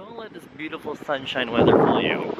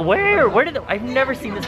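surprised; 13 semitones higher than usual. Retold or imitated. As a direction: act out gasping at a gift. Surprised at the gift.

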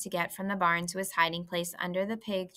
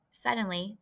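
ordinary delivery, nothing marked

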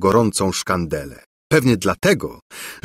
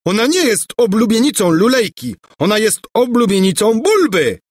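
a hammed-up roar, much higher and louder; 12 semitones higher, 3 dB louder than usual. A narrator's reading. Bulbo roaring at rival prince, mock furious. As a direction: bellow your claim like a jealous fool. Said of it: "ryczał Bulbo".

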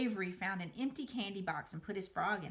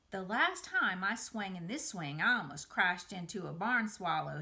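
ordinary delivery

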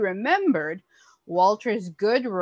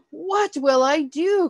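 alarmed, higher and louder cry; a baker panicking over spilt milk; cried out; 8 semitones higher, 5 dB louder than usual. A narrator's reading. Walter cries out in panic. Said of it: "cried Walter".

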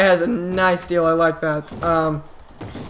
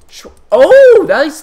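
startled yelp; 9 semitones higher, 10 dB louder, much slower than usual. Real, not acted. Sudden startle in the game.

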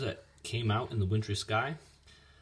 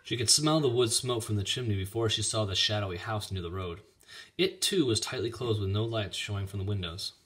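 usual speaking voice

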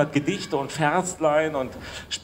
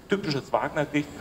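ordinary delivery

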